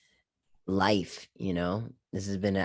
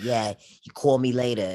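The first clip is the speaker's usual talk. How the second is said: put-on New York accent, a bit louder; 3 semitones higher, 4 dB louder than usual. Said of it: "I was trying to make my own New York accent".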